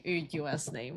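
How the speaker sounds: usual speaking voice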